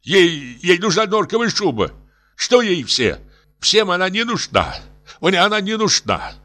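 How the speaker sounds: high-pitched, exasperated protest; irritated. A narrator's reading. Professor explains his wife's obsession, irritated high voice. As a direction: complain with rising agitation. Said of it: "раздражённо сказал профессор".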